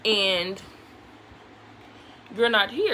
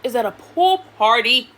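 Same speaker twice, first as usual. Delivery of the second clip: loud indignant pool party; indignant reveal; 12 dB louder than usual. Real, not acted. Telling viewers Christopher is at a pool party, loud and indignant. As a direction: land the outrage hard on pool party.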